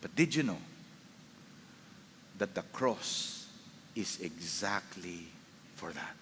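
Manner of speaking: low, slow and very soft; hushed, measured preaching; gentle assurance. Real, not acted. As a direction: let the comfort sink in, unhurried. Tender assurance after acted condemnation.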